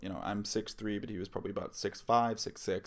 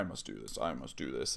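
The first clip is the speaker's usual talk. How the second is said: low, soft monotone chant; super low, demonic; 7 semitones lower, 5 dB quieter than usual. Retold or imitated. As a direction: imitate a possessed man repeating himself in a low drone. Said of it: "in a super low, demonic sounding voice".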